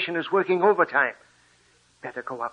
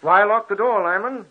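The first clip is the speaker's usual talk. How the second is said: raised, insistent voice through a locked door; demanding shout; 4 semitones higher, 6 dB louder than usual. Acted. Doctor shouting at friend behind locked door, demanding. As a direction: call loudly to be let in.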